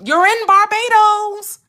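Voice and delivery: a high hyped-up friend insisting; high excited urging. Retold or imitated. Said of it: "What did Abdullah tell him?".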